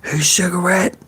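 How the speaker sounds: high-pitched mock holler; imitated holler. Cook hollering a greeting from the back kitchen, imitated holler. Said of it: "I would hear him holler".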